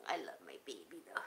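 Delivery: soft whispered affection. Real, not acted. Whispering love to her pet in her arms. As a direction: whisper it lovingly, low.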